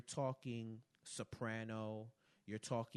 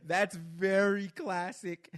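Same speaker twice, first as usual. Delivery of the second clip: ordinary delivery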